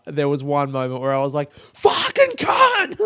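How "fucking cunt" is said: very high, drawn-out screech; frustrated shriek into the night; retold scream. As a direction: scream the curse slowly at full pitch. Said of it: "I screamed once on the walk".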